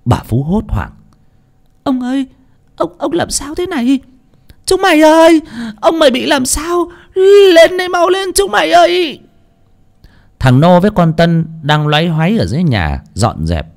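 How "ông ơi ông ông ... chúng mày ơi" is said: very high, crying call for help; panicked cry for help. A narrator's reading. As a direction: scream for the servants in terror. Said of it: "tiếng hô hoán thất thanh". (giọng hô hoán thất thanh, hoảng loạn)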